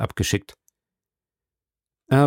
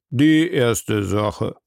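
very slow, drawn out, rising at the end; judge repeating slowly; much slower than usual. A narrator's reading. Judge repeating the case call, very slow with a rise. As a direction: stretch each word, a weary judge repeating himself. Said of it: "wiederholte der Richter Obadiah".

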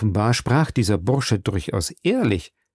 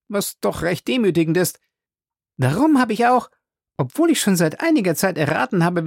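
higher, heated servant voice; indignant Passepartout; 7 semitones higher than usual. A narrator's reading. Passepartout accusing Fix of spying, higher and heated. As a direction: accuse him, tipsy and offended.

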